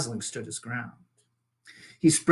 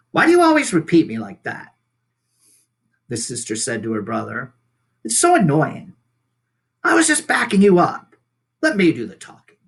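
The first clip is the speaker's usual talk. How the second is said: annoyed sister; 2 semitones higher, 7 dB louder than usual. Acted. Bear siblings bicker.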